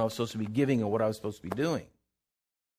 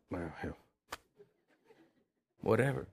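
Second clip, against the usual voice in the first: low, muttered brush-off; very soft, halting resignation; dismissive shrug; 4 semitones lower, 10 dB quieter than usual. Retold or imitated. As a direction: imitate someone grudgingly letting it go. Doctor's dismissive reply acted out.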